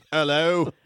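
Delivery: big brash Englishman; put-on British accent, full of swagger; Oaksy. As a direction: introduce yourself as a hearty cartoon Brit.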